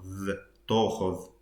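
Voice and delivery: تأخذ ending in a voiced th sound. Shown as a demonstration. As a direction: say تأخذ with the th of English "the". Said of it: "actually he says the as well".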